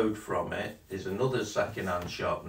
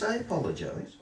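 mock apology; 10 semitones higher than usual. Real, not acted. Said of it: "you say you need sorry".

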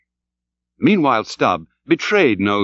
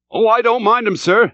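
high-pitched, raised crewman's voice; sailor calling back; 10 semitones higher than usual. A narrator's reading. Archie answers his officer, calling back high. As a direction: answer up from the oars.

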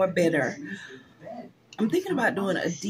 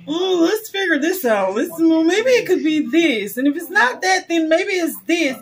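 a hint of a breezy, higher doctor voice mocking their guesswork; 7 semitones higher, 4 dB louder than usual. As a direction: lightly mimic a dismissive doctor stalling. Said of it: "and they just wanna".

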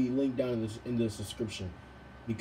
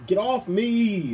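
shouting get off me; 7 semitones higher, 6 dB louder, slower than usual. Real, not acted. Sudden yell at sibling.

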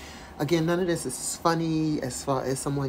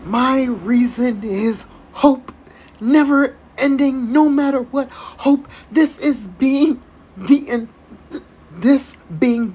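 loud, slow, higher mock-grand proclamation; mock dramatic reading; 7 semitones higher, 9 dB louder, slower than usual. Vlogger mocking the letter's big line, hammed-up proclamation. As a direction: declaim it like a grand manifesto.

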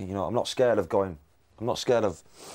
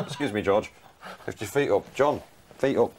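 a hint of a housewife with a hoover; mock polite cleaner, lightly done; lightly voiced cleaning lady. Cleaner interrupting the Beatles, mild put-on voice. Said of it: "as if some missus has walked in".

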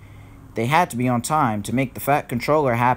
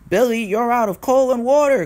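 high-pitched raised cry; raised storybook exclamation; driver crying out; 9 semitones higher, 4 dB louder than usual. A narrator's reading. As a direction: call out the bad news. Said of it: "cried his driver".

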